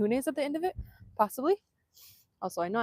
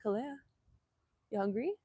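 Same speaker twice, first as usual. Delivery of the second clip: normal talking voice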